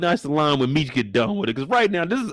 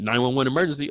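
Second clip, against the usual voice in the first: usual speaking voice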